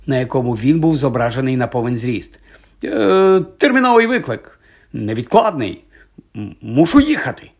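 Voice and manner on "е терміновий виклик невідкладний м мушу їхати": higher, halting, hesitant delivery; flustered excuse. Acted. Professor explaining his sudden departure, flustered and halting. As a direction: stumble through an awkward excuse.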